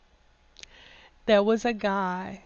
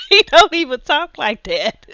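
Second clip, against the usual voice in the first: loud, higher, words pushed through laughter; laughing incredulously; 5 semitones higher, 8 dB louder than usual. Real, not acted. Vlogger scoffing at how Southern boys supposedly talk, laughing incredulously. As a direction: crack up in disbelief. Cracks up at the absurd quote.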